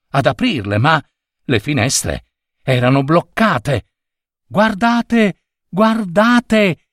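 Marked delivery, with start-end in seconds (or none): 4.5-6.9 high-pitched excited call, drawn-out words; child's cry. A narrator's reading. Child alerts classmates at the window, high excited cry. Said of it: "gridò un bambino".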